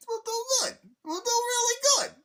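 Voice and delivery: very high, loud falsetto; squeaky Luigi voice. Retold or imitated. Luigi comforting his brother, squeaky falsetto. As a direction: reassure like nervous Luigi. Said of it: "You know".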